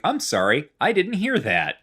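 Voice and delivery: like a phone menu that didn't catch you; automated phone voice. Retold or imitated. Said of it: "like a automated bank teller".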